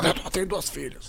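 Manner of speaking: soft, slow, laughing mimicry; mocking through laughter. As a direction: laugh while parroting his excuse. Mocking friend's excuse, laughing.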